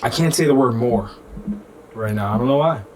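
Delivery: congested, stuffy speech; blocked nose. Real, not acted. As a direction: talk through a blocked nose. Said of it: "I'm so f***ing congested".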